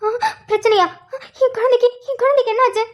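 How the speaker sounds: put-on a mother suddenly terrified for her child; panicked mother. Mother panics at the doctor about her son. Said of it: "emotional ஆகாதீங்க".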